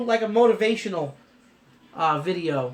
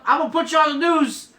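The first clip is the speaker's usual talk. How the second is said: higher and louder, playful threat to wife; teasing threat; 10 semitones higher, 8 dB louder than usual. Real, not acted. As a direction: tease her loudly, grinning. Teasing his wife on camera.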